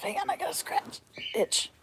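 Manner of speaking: whispered quick aside. Real, not acted. Quick aside while scratching.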